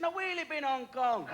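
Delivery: slow, high put-on Chinese accent for the payoff; mock Chinese accent. Punchline in the restaurant man's voice.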